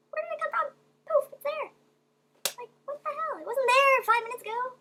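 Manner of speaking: baffled outburst at the bag; incredulous. Real, not acted. Finding the lost item.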